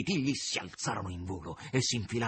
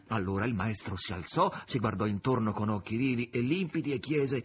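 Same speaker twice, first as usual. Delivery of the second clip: plain reading voice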